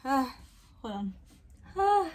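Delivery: sudden high catch in the breath; real tearful gasps; choking up. Real, not acted. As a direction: caught off guard by tears. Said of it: "I don't know why I'm getting emotional".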